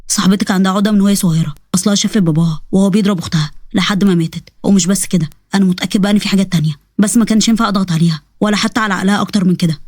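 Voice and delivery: doctor. Acted.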